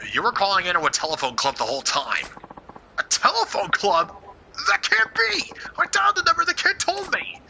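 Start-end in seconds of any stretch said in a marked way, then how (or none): 3.0-7.4 shocked. Acted. Kidnapper shocked by the truth.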